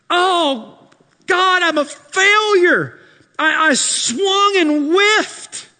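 high, loud despairing lament; discouraged self-talk. Retold or imitated. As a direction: moan over your own failure. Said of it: "the punch of discouragement".

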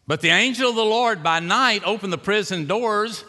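high, loud pulpit proclamation; preaching at full force. Acted. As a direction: lift the voice and proclaim it.

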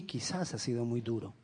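ordinary talk

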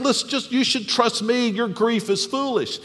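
dismissive. Pretend. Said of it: "dismiss her pain by saying".

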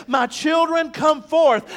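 loud, high summons to the graves; triumphant call. Acted. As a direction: call the dead to rise. Christ calling the dead.

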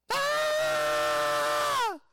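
long, high-pitched shriek; hammed-up scream of overblown fear; mock panicked scream. Mimics Elijah's bee sting panic.

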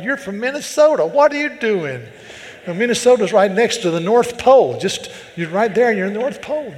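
loud, high comic disbelief; mock indignation. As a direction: scold in exaggerated disbelief, voice jumping up. Mock scolding his wife's amen.

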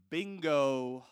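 a loud, punchy bingo; triumphant bingo. Retold or imitated. Pastor cheering as the executive finally gets it. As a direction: nail it like a quiz host.

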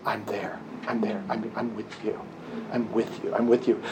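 soft, low and gentle, a comforting whisper-like hush; tender reassurance. Retold or imitated. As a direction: say it like holding a frightened child close. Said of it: "And he said".

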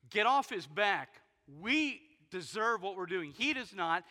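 loud, slow, forceful rebuke; rebuking shout. Acted. Thief on the cross scolding the other thief, loud and slow. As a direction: bark it out slowly at the mocker.

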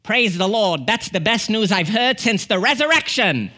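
mock-delighted Kiwi accent; New Zealand accent. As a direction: imitate an elated New Zealander. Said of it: "in his New Zealand accent, he goes".

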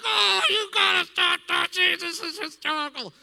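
loud, very high strained wail; hysterical outburst. Retold or imitated. Reacting to consoling hospice nurse, high hysterical wail. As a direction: squeal it out while losing control. Protests to nurse while cracking up.

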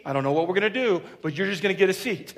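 quick, laughing throwaway promise; breezy empty reassurance, hurried; rushed mock promise. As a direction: rattle it off with a chuckle.

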